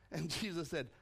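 usual speaking voice

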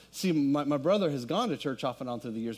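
ordinary talk, nothing marked

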